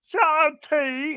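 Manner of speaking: high, slow, stretched fake British accent; bad British accent. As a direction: draw out each word in mock British. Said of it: "[name] tries it again".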